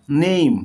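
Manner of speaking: নেম with a gliding "ei", "neim". Shown as a demonstration. Teacher giving an example of A, নেম said "neim". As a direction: sound the A in নেম as "ei". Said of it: "এর উচ্চারণ এই হয়".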